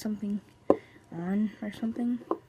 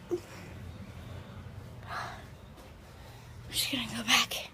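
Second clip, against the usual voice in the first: whispering; 5 dB quieter than usual. Real, not acted. Sneaking back to room.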